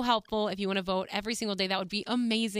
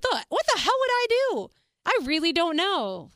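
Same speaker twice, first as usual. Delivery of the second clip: spooked; 8 semitones higher, 5 dB louder than usual. Real, not acted. Spooked imagining the nun.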